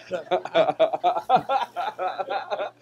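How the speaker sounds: bursts out laughing mid-sentence. Real, not acted. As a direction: laugh loudly at your own story. Laughs at his own story.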